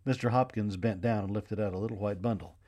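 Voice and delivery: ordinary delivery, nothing marked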